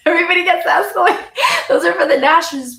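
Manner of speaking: laughing through the words, fast and high. Retold or imitated. Mom laughs while explaining to her son. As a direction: giggle out the correction. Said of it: "I was like".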